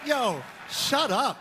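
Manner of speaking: high-pitched, exasperated protest to a crowd; defensive shouting. Real, not acted. Comedian defending his monologue to the crowd, shouting defensively.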